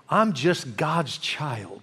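low, very slow and gentle; humble confession. Real, not acted. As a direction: let it settle slowly, a humble admission. Humble personal confession after listing titles.

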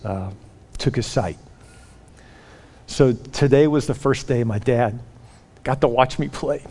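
low, soft, halting reveal; subdued confession. Retold or imitated. Player tells coach about his dad's blindness, subdued confession. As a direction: confide it gently with long pauses.

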